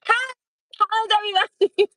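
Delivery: bright, high, sing-song hello to the room; loud bubbly greeting. Retold or imitated. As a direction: make a big entrance. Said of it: "I'ma step in a room".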